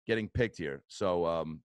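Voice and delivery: ordinary talk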